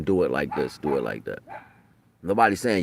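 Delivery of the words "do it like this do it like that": lower, softer guiding voice; lightly imitating someone giving directions; mock instruction. Nobody coaching the kids, mock instruction. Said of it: "Ain't nobody saying".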